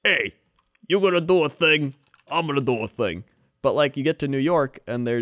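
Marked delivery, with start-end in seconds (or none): 0.0-3.2 Chicago construction worker. Retold or imitated. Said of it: "construction workers, just like".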